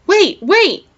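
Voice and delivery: high, loud calling out; storyteller's full-voiced cry to stop; urgent yell. A narrator's reading. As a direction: yell after people who are leaving. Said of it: "he yelled, racing after".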